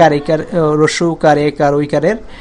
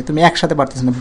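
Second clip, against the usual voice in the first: usual speaking voice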